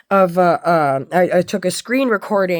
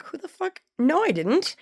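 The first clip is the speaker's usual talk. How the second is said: high incredulous squeak; shocked outrage; 9 semitones higher, 5 dB quieter than usual. Retold or imitated. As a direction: blurt it out in stunned disbelief. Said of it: "I'm like".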